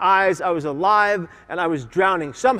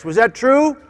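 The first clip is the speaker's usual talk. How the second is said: usual speaking voice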